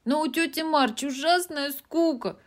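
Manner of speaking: put-on high, loud, anxious girl voice; worried little girl pleading; a bit frightened. A narrator's reading. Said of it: "сказала Эми довольно испуганно".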